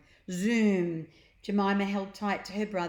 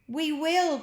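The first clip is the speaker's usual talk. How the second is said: high, louder joint reply; crying together; 9 semitones higher, 6 dB louder than usual. Acted. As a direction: promise it together. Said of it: "cried the pair in unison".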